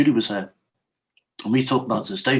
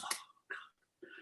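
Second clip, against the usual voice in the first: breathed out under the breath; a whispered oh God; whispered dismay; 22 dB quieter than usual. Retold or imitated. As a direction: let it out as a hushed whisper. Reaction to dogs mention.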